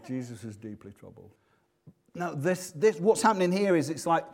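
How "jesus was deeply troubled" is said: quietly, with a laugh, mocking the tame wording; faint amused understatement; mock mild concern. Shown as a demonstration. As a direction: read it like a minor upset over football. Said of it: "like his football team lost on a weekend".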